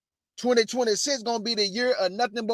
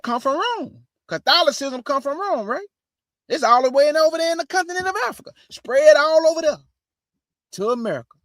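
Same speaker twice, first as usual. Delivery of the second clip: excited preaching; 7 semitones higher than usual. Real, not acted. Rising preaching climax.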